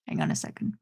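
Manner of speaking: very soft, low, whispery; hushed aside. Real, not acted. Speaker stalls after announcing Silent Night, hushed aside. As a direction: murmur it almost without voice.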